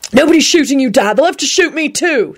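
high-pitched defiant protest; defiant shout. A narrator's reading. Jamie to Dad before the gunmen, defiant shout. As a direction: call it out in defiance. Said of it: "Jamie shouted defiantly".

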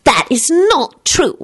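high, slow, stern correction. A narrator's reading. Solange contradicting Timothy, stern. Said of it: "Solange told him sternly".

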